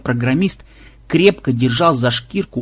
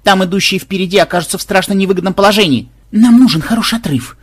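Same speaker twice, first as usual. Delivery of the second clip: high, louder shout over the race; mild shouting; 6 semitones higher, 5 dB louder than usual. A narrator's reading. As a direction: call out urgently. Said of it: "крикнула внучка".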